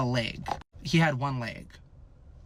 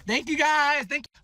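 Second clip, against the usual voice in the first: mock acceptance speech; 10 semitones higher, 4 dB louder, slower than usual.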